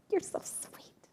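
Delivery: breathy whisper, no voice at all; whispered you're so sweet; whispered aside. Retold or imitated. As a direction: whisper it like a shy secret. Retold brush-off of compliment.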